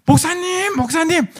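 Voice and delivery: very high, drawn-out call to the pastor; acted excited calling. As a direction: play someone hailing the pastor with big news. Man calls out excitedly to pastor.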